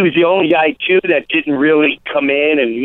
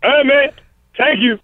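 humble Eddie Murphy; 7 semitones higher, slower than usual. Retold or imitated. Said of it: "it came out humble".

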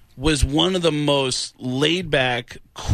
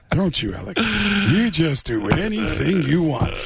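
Al Pacino impression. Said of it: "Al Pacino's looking at him going".

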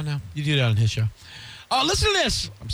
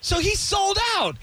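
shocked; slower than usual. Real, not acted. Mock shocked realization.

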